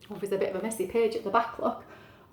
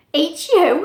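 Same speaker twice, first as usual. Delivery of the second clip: loud, higher, incredulous exclamation; acted cat's big reply; storybook cat character; 7 semitones higher, 13 dB louder than usual. A narrator's reading. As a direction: boom it out as the astonished cat. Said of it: "he said".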